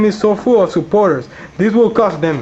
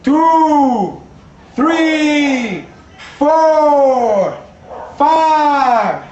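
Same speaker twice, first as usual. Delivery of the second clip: higher count with laughs; shouted referee count; 5 semitones higher, much slower than usual. Acted. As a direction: count it out like a ref.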